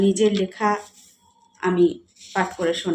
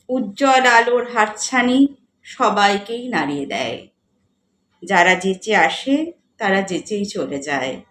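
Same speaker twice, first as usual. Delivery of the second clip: measured recital with lifted line openings and falling ends; poetry recitation; 5 dB louder than usual. Acted. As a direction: recite the verse with feeling, pausing between phrases. Recites own poem, first of three. (কবিতা আবৃত্তি)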